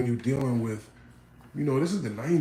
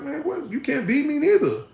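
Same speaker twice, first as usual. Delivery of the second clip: much higher, loud boastful taunt with a laugh; cocky challenge; 12 semitones higher, 3 dB louder than usual. Retold or imitated. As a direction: dare them to try him. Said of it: "I would be like".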